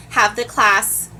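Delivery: ordinary talk, nothing marked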